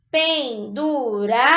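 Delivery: Penduradas said slowly as pen-du-ra-das; syllable by syllable. Shown as a demonstration. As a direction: sound out Penduradas for the class. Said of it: "eu emiti quatro sons".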